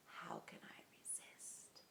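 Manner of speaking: hushed whisper to himself. A narrator's reading. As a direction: breathe the greedy thought. Fox's greedy private thought.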